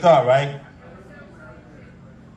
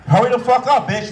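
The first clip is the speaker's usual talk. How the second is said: mock impatient yell; 9 semitones higher, 5 dB louder than usual. Impatient inner shout.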